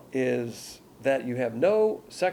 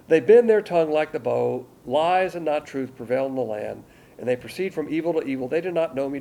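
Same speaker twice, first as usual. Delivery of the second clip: loud, declaiming reading of scripture; forceful scripture reading; 5 dB louder than usual. Acted. Preacher rereading the verse to his congregation, loud declaiming. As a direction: thunder the verses out.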